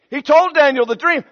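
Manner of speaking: usual speaking voice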